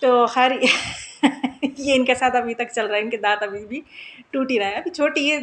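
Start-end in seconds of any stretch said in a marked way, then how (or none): 0.6-1.7 quiet, airy suppressed laugh; stifle a giggle; hushed laugh at a mishap; soft giggle. Real, not acted. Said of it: "मुझे अभी भी हंसी आ रही है".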